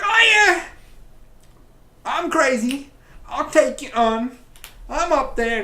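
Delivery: high, loud hammed-up villain taunt; mock crazy villain. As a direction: yell it like a cartoon madman daring the hero. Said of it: "the main leader goes like".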